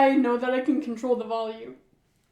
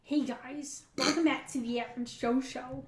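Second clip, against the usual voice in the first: softer, restrained character greeting; quiet celebrity impression; 8 dB quieter than usual. Host greeting viewers, trying the voice quietly. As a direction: hold the voice down while imitating. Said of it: "I'm trying to be quiet, but, like, do his voice".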